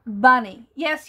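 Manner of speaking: usual speaking voice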